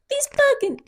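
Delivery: very high mock indignation; mock headline outrage. Mimicking the rival news story for friends, high mock outrage. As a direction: pitch right up for a parody headline rant. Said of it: "it's, like".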